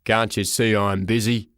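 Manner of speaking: gruff railway porter; surly man's deep voice; deep growl. Acted. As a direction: growl it low, as if interrupted. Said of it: "he growled in a deep voice".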